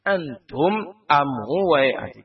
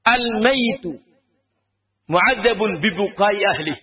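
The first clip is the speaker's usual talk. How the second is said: raised, drawn-out chant of the Arabic hadith; hadith recitation; 4 semitones higher than usual. Shown as a demonstration. Preacher quoting the hadith to the congregation, chanted. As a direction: intone the Prophet's words with weight. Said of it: "Nabi kita menyebutkan dalam hadits".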